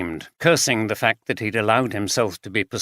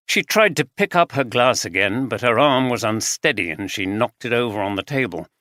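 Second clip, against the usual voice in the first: ordinary delivery, nothing marked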